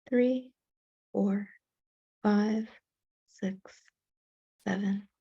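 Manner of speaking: slow, measured meditation voice with long pauses; guided meditation. Real, not acted. Teacher leading a class in self-compassion breathing, guided meditation. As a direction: count the breath out evenly, unhurried, guiding them into stillness.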